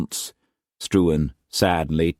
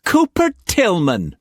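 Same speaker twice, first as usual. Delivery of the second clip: bitterly; 5 dB louder than usual. A narrator's reading. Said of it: "she said bitterly".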